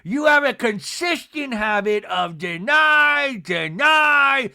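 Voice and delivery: loud, slow mock accusation; theatrical put-on scolding; Meredith impression. Mimics Meredith accusing Angie.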